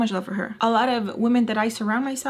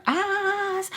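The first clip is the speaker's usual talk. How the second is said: sustained, pitched-up emphasis; high drawn-out word; 11 semitones higher than usual. Retold or imitated. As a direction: stretch it out high. Drawn-out high end of wish.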